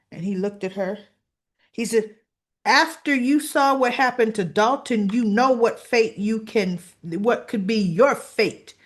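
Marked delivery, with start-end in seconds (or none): 2.7-8.7 loud, forceful threat; menacing warning. Retold or imitated. Boss warning the intruding woman about her fate, menacing. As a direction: press the threat hard, slowing at the end.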